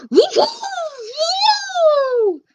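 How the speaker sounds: very high, loud whoops; whooping. Acted. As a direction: whoop wildly to wake the giant. Jack blows horn, whooping.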